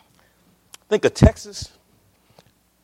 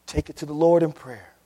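usual speaking voice

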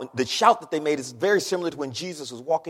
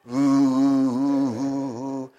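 imitating spinning pottery wheel; 4 semitones lower, 5 dB louder, much slower than usual. Pottery smacked back down.

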